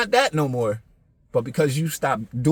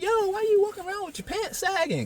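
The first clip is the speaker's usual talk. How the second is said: very high-pitched, softer mimicked question; mock challenge; 5 dB quieter than usual. Someone questioning kids about sagging pants, high mimicking voice.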